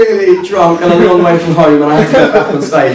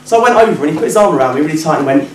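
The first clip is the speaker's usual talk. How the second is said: ordinary talk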